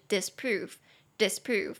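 disprove, dis light and prove stressed, said slowly twice; stress on second syllable. Shown as a demonstration. Teacher modelling disprove slowly, stress on prove. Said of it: "重音节在第二，disprove".

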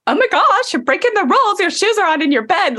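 high, mock-horrified scolding of yourself; mock alarmed scolding. Guest caught breaking my own rule, mock-horrified scold. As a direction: clutch your pearls at shoes on the bed.

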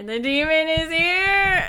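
loud, slow doom-laden proclamation; mock ominous announcement. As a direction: announce the demon like a prophet. Dog knocked mic, mock alarm.

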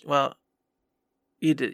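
usual speaking voice

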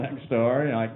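ordinary talk

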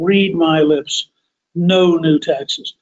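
'Read my lips, no new taxes' slow, READ and NO stressed; a hint of Bush's campaign delivery; emphatic slow quote. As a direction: deliver the punchline slowly, word by word. Climax of quoted pledge.

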